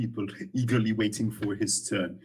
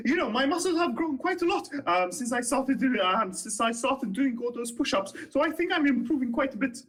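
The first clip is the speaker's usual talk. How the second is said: put-on high, eager young halfling guard boasting; 12 semitones higher than usual. Young halfling guard to the player, eager and high. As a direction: pitch it up like a keen young guard inviting a friend.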